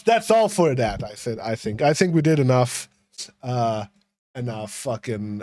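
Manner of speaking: ordinary talk, nothing marked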